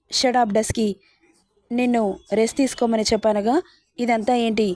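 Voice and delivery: usual speaking voice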